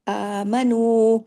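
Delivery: آمنو with نو drawn long; stretched madd. Shown as a demonstration. Teacher isolates the long vowel for students, drawn out. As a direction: lengthen the و of آمنو to two counts. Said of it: "واو مدہ ہے".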